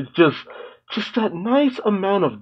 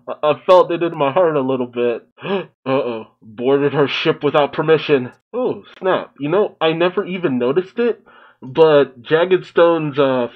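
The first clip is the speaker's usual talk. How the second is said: ordinary talk, nothing marked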